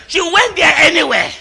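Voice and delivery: high, loud preacher's cry; loud, high sermon build; preaching at full force. Acted. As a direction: preach it out with fire.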